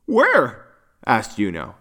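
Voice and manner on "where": high one-word question; eager question. A narrator's reading. Yuno asks Poppy where the parents are, eager question.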